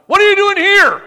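huge, high, roaring shout of the question; booming voice of God. Retold or imitated. God roaring down at Elijah in the cave, booming shout. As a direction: thunder it down from heaven.

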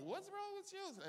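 high-pitched mock onlooker voice; disapproving onlookers. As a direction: squeak it as a scandalised bystander. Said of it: "people look at you like".

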